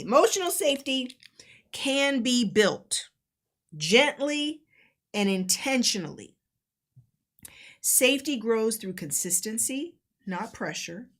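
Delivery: slow, measured delivery with long pauses; deliberate, reflective teaching read; reading out guidance. Acted. Reads out guidance on emotional safety.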